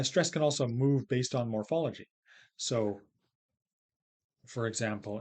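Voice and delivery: usual speaking voice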